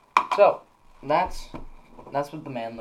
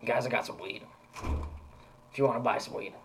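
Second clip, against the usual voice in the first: gruff voice. Retold or imitated. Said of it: "talked in, like, a more gruff voice".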